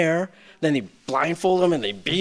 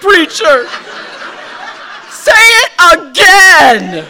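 high loud shouted preacher voice; laughing mock preacher shout; 12 semitones higher, 12 dB louder, much slower than usual. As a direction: bellow it like a revival preacher.

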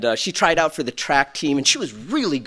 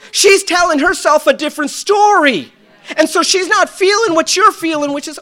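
normal talking voice

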